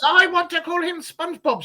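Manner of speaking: put-on high little-girl voice; mock childish daughter voice. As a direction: squeak it like a small child. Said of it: "she doesn't talk like that".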